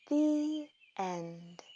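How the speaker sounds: usual speaking voice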